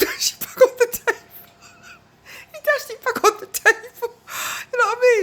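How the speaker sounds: high, loud through real laughter; can't stop laughing as you tell it; squealing with laughter at the story. Real, not acted. Laughing while retelling.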